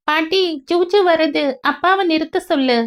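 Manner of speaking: put-on a little boy begging grandma to stop; small boy voice. Boy begging grandma to stop the car, child's voice.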